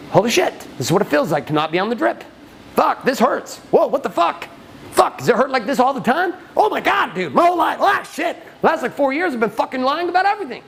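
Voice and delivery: high-pitched, pained disbelief; acted panic building to a high exclamation; shocked outburst. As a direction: play someone reeling as the pain hits. Acts client shocked by pain.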